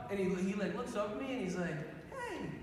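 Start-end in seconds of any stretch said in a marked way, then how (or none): 2.1-2.5 high, soft, meek little greeting; sweet man. Retold or imitated. Fallen man looking up at his helper, sweet and meek. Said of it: "the sweetest man I've ever met".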